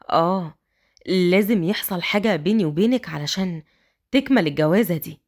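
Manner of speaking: low tender man's voice; tender husband. A narrator's reading. Raad explains.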